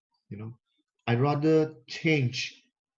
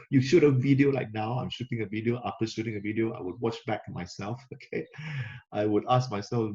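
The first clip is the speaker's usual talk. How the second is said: ordinary talk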